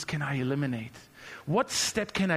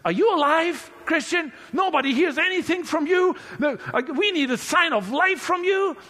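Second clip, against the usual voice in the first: much higher, louder, panicky mother; frantic mother; 10 semitones higher, 6 dB louder than usual. Retold or imitated. Mother calling her son, frantic and loud. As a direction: burst in like a worried mom on the phone. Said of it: "My mother called me frantically".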